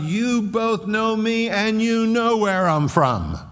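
loud, high proclaiming cry; Jesus crying out in the temple, loud and high; yelled out loud. Acted. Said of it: "Jesus stands up and yells out loud".

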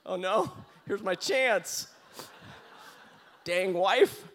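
high, loud comic exasperation; mock dismay. Husband inwardly grumbling at his wife's kindness, mock dismay. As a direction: lament it loudly, drawing out the words. Acts out his inner reluctant groan.

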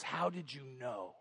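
very soft, low and slow; hushed astonishment. Retold or imitated. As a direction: barely voice it, acting stunned. Stunned worker drops pen and paper.

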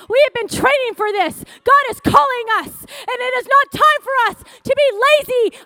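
loud, high preaching at full force; fervent preacher's shout; excited shouting. Real, not acted. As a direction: cry it out to rouse the church. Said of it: "Sorry, I get excited. I know, I scream".

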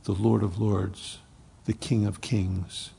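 usual speaking voice

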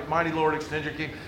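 softly muttered, resigned, no longer sung; deflated mumble. Retold or imitated. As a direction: let the hymn words fall flat and quiet. Deflated after nobody came.

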